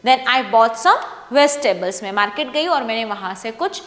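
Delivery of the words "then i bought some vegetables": slow, clear read with a falling end at the full stop; reading with proper intonation. Shown as a demonstration. Teacher reading a paragraph aloud for students, slow with clear intonation. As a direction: read it slowly, letting the voice drop at the full stop. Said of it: "proper intonation का use करके".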